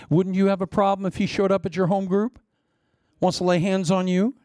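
usual speaking voice

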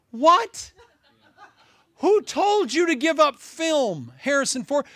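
high, stunned disbelief; incredulous. Real, not acted. Preacher incredulous at converts quitting their gifts. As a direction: throw it back in disbelief.

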